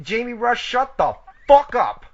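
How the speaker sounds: "shut the fuck up" drawn out, high and loud; emphatic delivery. Shown as a demonstration. Showing the co-host how to tell Jamie Rush off, emphatic. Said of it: "No, e-, emphasize it".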